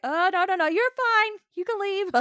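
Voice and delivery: doctor rushing a nervous patient out, hammed-up reassurance; hasty Bashir. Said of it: "Bashir's like".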